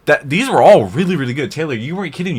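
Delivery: excited. Real, not acted. Excited about the videos.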